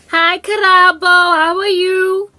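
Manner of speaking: high, sing-song phone greeting, slow on how are you; receptionist on the phone. Retold or imitated. Receptionist Cheryl phones upstairs, high sing-song greeting. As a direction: play the chirpy office receptionist calling up.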